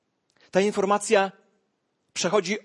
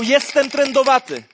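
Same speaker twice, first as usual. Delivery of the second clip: loud, higher call of warning; shouting; 8 semitones higher, 9 dB louder than usual. Acted. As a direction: cry it out to the people around. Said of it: "a on szedł i krzyczał". (głośny, wyższy okrzyk)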